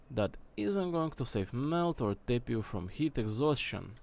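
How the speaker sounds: distant off-mic take; far from the mic. Shown as a demonstration. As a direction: read it from across the room. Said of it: "million years away from the mic like this".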